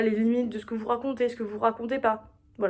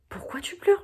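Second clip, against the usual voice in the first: high, gentle asking why; curious question to a crier; 9 semitones higher than usual. Acted. To someone crying in public, a much higher asking voice. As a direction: lean in and ask a crying stranger why.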